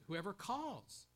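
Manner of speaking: calls hit high, then falling; stress on calls. Shown as a demonstration. Preacher pointing out the calling in the verse, stress on calls. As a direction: punch the word calls. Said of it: "put the emphasis on the calling".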